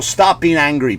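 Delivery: usual speaking voice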